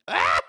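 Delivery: short, loud startled cry; sudden yelp. Real, not acted. Player interrupted mid-sentence, startled yelp. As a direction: yelp abruptly, cutting yourself off.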